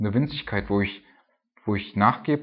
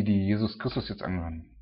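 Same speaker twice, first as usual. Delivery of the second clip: ordinary talk, nothing marked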